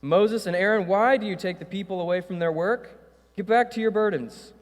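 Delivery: a touch of an angry king; stern Pharaoh. Acted. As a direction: snap at them like a king. Pharaoh rebukes Moses and Aaron.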